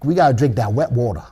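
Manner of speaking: lightly put-on Cajun accent, brisk; a hint of a Cajun accent, playful and quick. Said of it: "That's my Cajun accent".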